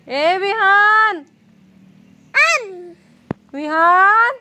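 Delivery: long drawn-out call across the yard. Real, not acted. Mother calls her son from across the yard, long loud call. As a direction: stretch the name out and call him from far off.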